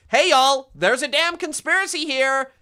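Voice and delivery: put-on loud, brash American accent; mock American accent. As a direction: belt it out like a cartoon American in an American accent. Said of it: "The American Burger Man comes in and goes".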